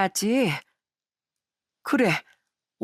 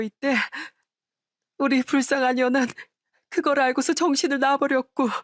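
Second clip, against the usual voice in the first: high, tearful lament over the daughter; sobbing wife; 5 semitones higher than usual. Acted. Wife confesses the daughter's misfortune to her husband, sobbing. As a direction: pour out grief through tears. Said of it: "오열을 하며".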